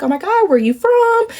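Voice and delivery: high-pitched, delighted curiosity; sweetly hammed-up interest; friendly gushing. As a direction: ask in an imitated high, gushing voice. Voicing Michelle's friendly interest.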